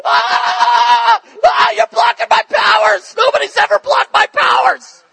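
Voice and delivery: high, loud, screeching witch voice, shrieking in alarm; a witch screaming that her powers are being blocked, very high and loud. Retold or imitated. Witch shrieks when power hits.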